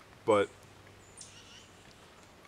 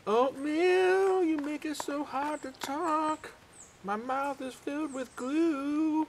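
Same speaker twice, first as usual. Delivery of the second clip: much higher, slow, mouth full; mouth full squeaky voice; much slower than usual. Pretend. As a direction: squeak slowly through sticky oatmeal. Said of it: "Voice of a generation".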